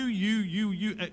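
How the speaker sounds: usual speaking voice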